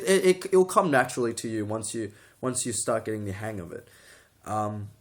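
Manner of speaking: normal talking voice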